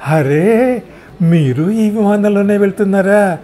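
delighted surprise. Acted. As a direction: greet an old acquaintance brightly.

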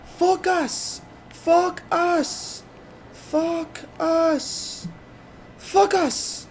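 very high-pitched mock Italian accent; Italian teacher accent. Gamer mimicking his Italian teacher, high-pitched accent. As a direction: squeal it in exaggerated Italian-accented English. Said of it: "English with her accent".

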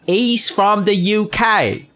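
high, slow put-on British accent; mock British accent. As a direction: drag it out in a hammed-up British accent. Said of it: "That's how people talk".

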